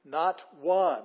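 "one" punched loud; both words separated, "Not" and "one" hit hard; emphatic NOT ONE. Shown as a demonstration. Driving home the literal meaning.

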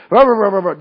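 hammed-up griping noise; loud mock grumbling. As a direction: gripe out loud like a cranky complainer. Mimicking murmuring after griping.

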